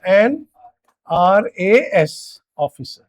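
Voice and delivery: "RAS officer" stretched out very slowly; slow dictation. Shown as a demonstration. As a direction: dictate the letters of "RAS" one by one. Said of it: "तो क्या लिखना पड़ेगा". (बहुत धीरे-धीरे लिखवाना)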